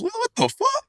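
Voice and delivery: very high squeaked what the fuck; stunned disbelief. Retold or imitated. Player shocked to see a teammate on TV, very high squeal. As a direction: yelp it in total disbelief.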